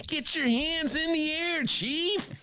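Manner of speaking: high, slow, drawn-out impression voice; host doing Jack Nicholson on request. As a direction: do your best Nicholson, stretched out. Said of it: "That's Jack Nicholson".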